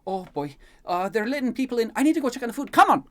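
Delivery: high, loud and panicky, building to a shout; Elaine, flustered urgency. Acted. Elaine hears guests let in, flustered shouting. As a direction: hurry them along, rising to a yell.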